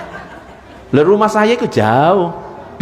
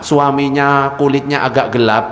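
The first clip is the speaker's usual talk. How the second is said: normal talking voice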